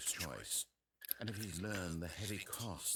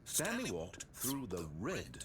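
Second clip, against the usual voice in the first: "Stanley walked through the red" said slowly, word by word; slow over-clear repeat; slower than usual. Acted. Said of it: "perhaps you misunderstood".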